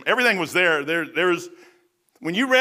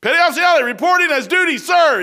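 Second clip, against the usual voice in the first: loud formal report; 8 semitones higher, 6 dB louder than usual. Retold or imitated. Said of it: "I said".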